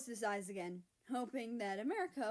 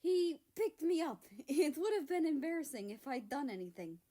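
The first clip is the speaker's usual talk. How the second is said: high, loud flustered protest; 5 semitones higher, 6 dB louder than usual. A narrator's reading. Son flustered, defending himself to his teasing dad. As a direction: blurt it out, embarrassed and defensive. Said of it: "trying to keep himself from blushing".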